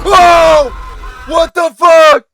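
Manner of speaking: very loud, high-pitched shriek; real startled screaming; terrified scream. Real, not acted. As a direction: scream the curse in pure fright. Second jump scare in game.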